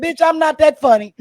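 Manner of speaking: loud, sassy retort; half-laughing sassy put-down; mock snap. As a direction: brush the fan off loudly. Snapping at a fan.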